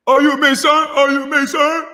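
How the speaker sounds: high, loud mocking parrot of the question; shrill mock whine of the question; mocking imitation. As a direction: squeal it back like a nagging pest. Commentator mocks the other man's question.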